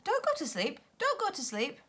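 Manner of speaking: high urgent plea; urgent cry. A narrator's reading. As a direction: cry out to keep them awake. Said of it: "cried Silky".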